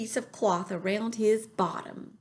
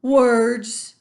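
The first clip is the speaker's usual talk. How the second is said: high, loud friendly giant with a muddled tongue; BFG voice; 4 semitones higher, 10 dB louder than usual. A narrator's reading. Reader voices the giant to listeners. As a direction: lift the voice into the big kind giant. BFG voice in read-aloud story.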